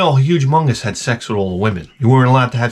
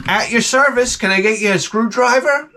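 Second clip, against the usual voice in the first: higher mock helpful offer; fake-polite waiter tone; sarcastic politeness; 8 semitones higher than usual.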